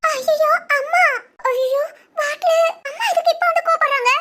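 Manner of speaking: high-pitched, slowing into dismayed muttering to herself; flustered panic. Acted. Daughter panicking as mother calls while the bottle is out, flustered.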